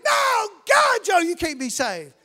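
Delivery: loud, high, indignant telling-off; scolding shout. Retold or imitated. Church members berating Joe at the altar, shouting. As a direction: scold him like the worst sinner. Church folk insisting he's unsaved.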